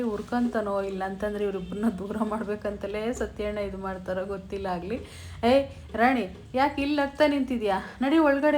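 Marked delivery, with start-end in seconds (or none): 5.4-8.6 put-on loud, higher scolding of a girl caught laughing; retold scolding. Uncle yelling at his niece to get inside, loud angry scolding. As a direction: bark her back indoors, loud and high. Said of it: "ಅಂತ ಬೈದ್ಬಿಡ್ತಾನೆ".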